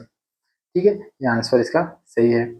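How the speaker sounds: ordinary talk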